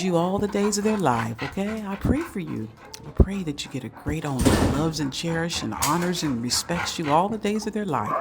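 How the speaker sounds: low, soft prayerful voice; prayer for a dog. Real, not acted. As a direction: bless the dog quietly. Praying over a dog.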